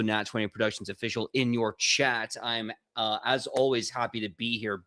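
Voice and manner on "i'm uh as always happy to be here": ordinary talk, nothing marked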